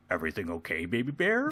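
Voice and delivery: low, soft gruff-hero concern; Worf. Acted. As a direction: check on the ensign in a deep, quiet voice. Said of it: "imagine if just Worf was, like, going around".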